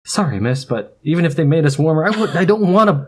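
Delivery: breaking up mid-line; laughing through lines. Acted. Tries maid voice, giggling.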